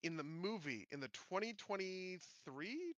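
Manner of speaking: ordinary talk, nothing marked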